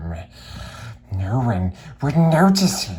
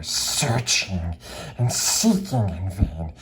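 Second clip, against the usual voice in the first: plain reading voice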